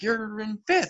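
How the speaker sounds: high-pitched mock call-out; retold yell. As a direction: call it out like a spectator. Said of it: "someone yelled out".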